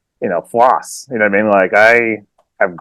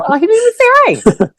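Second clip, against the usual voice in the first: much higher, chirpy greeting to a stranger on the trail; overly friendly greeting. Retold or imitated. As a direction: sing out hello to a passing hiker. Imitating himself on the trail.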